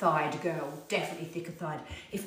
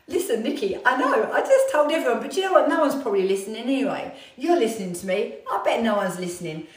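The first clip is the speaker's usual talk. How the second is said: giddy laughing; 6 semitones higher, 10 dB louder, faster than usual. Real, not acted. Laughing chat with Nikki.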